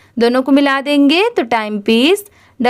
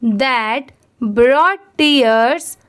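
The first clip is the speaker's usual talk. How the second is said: very slow, word by word, for students to repeat; very slow read-along; much slower than usual. Shown as a demonstration. Teacher reading to students who repeat each phrase, very slow. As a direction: spell out each phrase very slowly so the class can echo it. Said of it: "आप मेरे बात ज़रूर repeat करिए".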